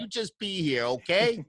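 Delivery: half-joking wise guy sealing the deal; mock mobster. Radio host answering the singer's Gambino joke as a playful mob boss. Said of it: "start calling you Gambino".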